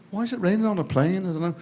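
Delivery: put-on high-pitched, baffled kid's voice; puzzled schoolboy. Pupil questioning elocution drill in assembly, puzzled and high. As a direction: pipe up high, querying the nonsense. Mock pupil's reply to the recital.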